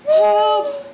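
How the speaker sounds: high, loud mock cries of help; mother mimics son's scream for help, loud and high; imitating child's screams. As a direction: echo the boy yelling for help. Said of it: "He's just screaming".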